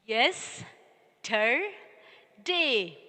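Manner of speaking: "Yes. Ter day" in three separate beats; syllable by syllable. Shown as a demonstration. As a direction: pause after "Yes" and after "Ter", then "day". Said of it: "Did you do this?".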